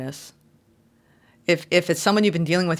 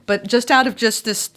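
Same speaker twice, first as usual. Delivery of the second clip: moved to tears; 6 semitones higher than usual. Real, not acted. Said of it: "I feel emotional right now".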